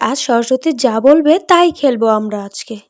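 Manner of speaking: usual speaking voice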